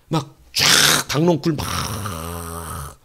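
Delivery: very slow, low and stretched; drawn-out. Acted. Preacher jokes about the gourd vine, slow and low. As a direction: drag it out comically.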